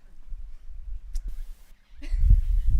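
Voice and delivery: big, loud laugh; elephant's laugh. A narrator's reading. Elephant laughs at the young dog's answer, amused. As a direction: laugh heartily in response. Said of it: "The elephant laughed in response".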